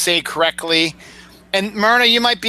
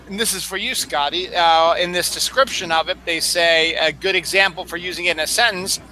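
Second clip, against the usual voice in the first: ordinary delivery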